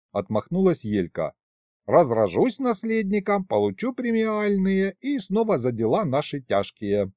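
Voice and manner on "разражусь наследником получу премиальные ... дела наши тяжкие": high, folksy female voice; breezy kikimora. A narrator's reading. Kikimora brushing off grandfather, high and breezy. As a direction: announce plans in a high voice. Pregnant kikimora brags of plans.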